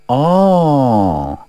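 long, low drawn-out "oh"; pondering acknowledgement. Acted. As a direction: let it sink slowly, thoughtfully. Fortune-teller takes in the story, nodding.

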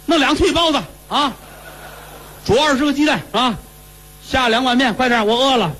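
high, lively demanding voice; loud eager orders. Acted. Cured son ordering steamed buns from the steward, high and eager. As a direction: bark out food orders all at once. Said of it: "这说话音儿不小啊".

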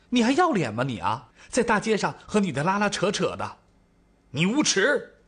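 acted quarrel, outraged accusation; angry berating. A narrator's reading. Acted quarrel, wife berates husband.

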